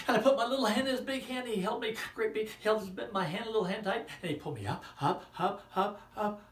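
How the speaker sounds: high, loud and fast building climb; excited telling. Acted. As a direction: pile up the excitement, up, up.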